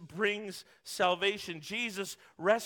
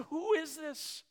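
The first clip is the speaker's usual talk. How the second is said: higher, wondering; awed question; 7 semitones higher than usual. Retold or imitated. Disciples amazed at Jesus, wondering aloud. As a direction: marvel at who he is.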